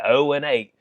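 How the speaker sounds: ordinary delivery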